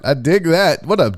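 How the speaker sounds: ordinary delivery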